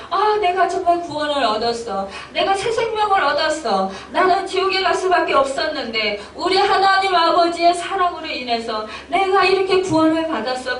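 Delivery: joyful testimony. Acted. New believer rejoicing at being saved, joyful exclamation. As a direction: exclaim it with overflowing joy. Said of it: "기뻐하면서 하나님을 찬양하게".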